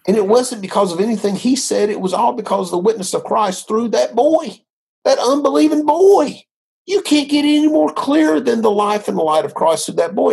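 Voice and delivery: high-pitched, fervent insistence; real amazement, pitched well up; excited testimony. Real, not acted. Amazed testimony of a God moment.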